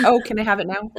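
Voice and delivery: laughing, hammed-up eager newcomer; playful settler asking. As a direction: pretend to ask cheekily for free land. Playfully voicing land-hungry settlers.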